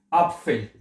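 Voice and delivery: एप्पल said "AHP-fel", open "aa" first; German pronunciation. Shown as a demonstration. Teacher reads out the example word, German "AHP-fel". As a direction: say एप्पल with an "aa" start and pf. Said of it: "इसको एप्पल".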